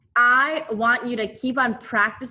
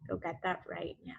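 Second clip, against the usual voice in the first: softer and faster, almost an aside; soft quick repeat; 2 semitones lower, 13 dB quieter, faster than usual. Shown as a demonstration. Teacher gently repeats the instruction to the class, soft and quick. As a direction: drop the volume and hurry the reminder.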